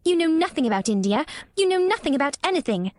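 put-on high raging spoilt girl. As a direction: lash out in rage. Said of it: "her rage and humiliation".

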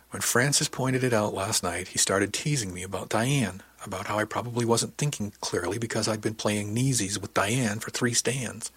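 ordinary delivery, nothing marked

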